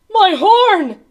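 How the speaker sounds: high, loud cry of dismay; gulped dismay. A narrator's reading. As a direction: cry out in shock at a loss. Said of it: "he gulped".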